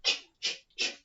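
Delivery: ch, ch, ch as unvoiced puffs; whispered ch sounds. Shown as a demonstration. Teacher whispering the ch sound for phonics. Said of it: "what sound does chicken start with".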